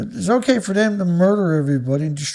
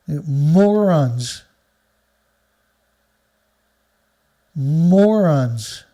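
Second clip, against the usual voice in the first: contemptuous; 2 semitones lower, 3 dB louder, much slower than usual. Real, not acted. Insulting the people he criticises.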